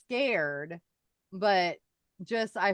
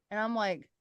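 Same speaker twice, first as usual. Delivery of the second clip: ordinary talk, nothing marked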